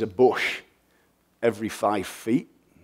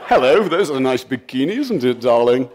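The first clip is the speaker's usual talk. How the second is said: louder, hammed-up English charmer accent; 8 dB louder than usual. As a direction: lay the English accent on for a flirty line. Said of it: "the power of an accent".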